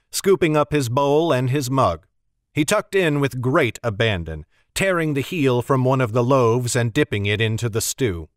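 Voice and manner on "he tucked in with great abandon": ordinary delivery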